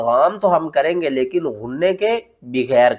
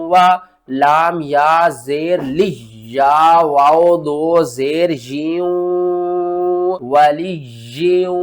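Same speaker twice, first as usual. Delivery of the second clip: slow chanted letter-by-letter spelling; spelling recitation; much slower than usual. Shown as a demonstration. Teacher spells out the verse in a chant for students. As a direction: chant the letters and vowels, then join them. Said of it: "किस तरह?".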